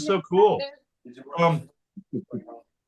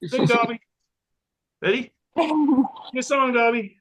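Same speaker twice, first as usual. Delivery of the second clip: higher-pitched pet-coaxing voice; coaxing a dog; 5 semitones higher than usual. Real, not acted. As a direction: urge your dog to perform on cue.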